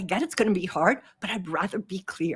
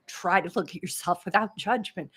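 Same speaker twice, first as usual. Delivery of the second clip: normal talking voice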